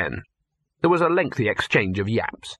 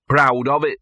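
indistinct, through food; mumbled with full mouth; 3 semitones higher, 5 dB louder than usual. A narrator's reading. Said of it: "said Gaspode indistinctly".

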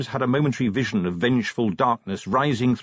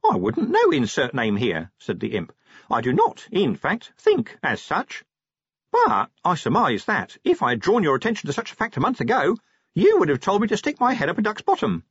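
higher, chirpy little imp; a small helpful creature, pitched up; 8 semitones higher than usual. Acted. Imp replies.

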